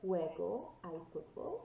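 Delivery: slow, loud model "Juego al fútbol" with J as H. Shown as a demonstration. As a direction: say "Juego al fútbol" slowly for a class to repeat. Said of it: "always sounds like an H".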